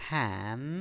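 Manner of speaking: ହ୍ୟାନ୍ alone as a long "haan", low and soft; first syllable of handsome, no d; syllable model. Shown as a demonstration. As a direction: draw out "han". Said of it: "So, H A N".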